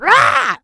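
high loud mock roar of rage; hammed-up rage yell; angry roar. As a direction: let out a furious growl. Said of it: "I was like".

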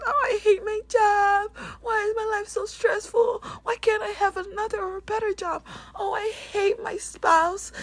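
high, loud mock whining; hammed-up moaning about your job; whiny complainer. Mocking complaining people.

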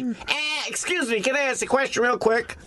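high, meek nerdy asker; high-pitched mock impression of a shy fan; imitating Scott. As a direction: squeak out the question timidly. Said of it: "he sounded just like that".

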